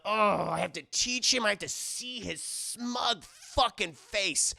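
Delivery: slow, loud villainous seething; hammed-up loathing, dragging out the words; evil teacher. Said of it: "These teachers are fucking evil".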